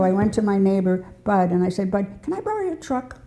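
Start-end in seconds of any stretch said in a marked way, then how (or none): 1.9-3.2 much higher, softer coaxing request; sweet asking favor. Retold or imitated. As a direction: coax the neighbour sweetly for the truck. Asking neighbor for truck.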